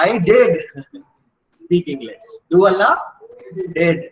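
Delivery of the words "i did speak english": "did" stands out, the rest falls away; emphatic DID. Shown as a demonstration. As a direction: stress "did" firmly, then slow down. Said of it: "you are emphasizing that you know English".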